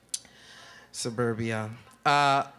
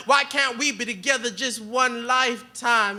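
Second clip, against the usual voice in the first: poetry performance; 4 semitones higher, 3 dB louder than usual. Acted. Spoken word poem, refrain line.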